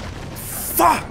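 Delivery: sudden high, loud curse; frustrated scream. Real, not acted. Player who just died in the game, frustrated scream. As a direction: explode in a real yell.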